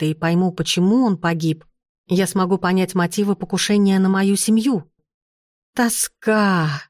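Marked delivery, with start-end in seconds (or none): 5.7-6.8 drawn-out wail of longing; ghostly moan. A narrator's reading. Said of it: "простонало привидение".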